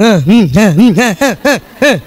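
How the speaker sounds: half-listening absent grunts; distracted mumbling husband. A husband busy with his gadget grunting at his wife, absent mumbles.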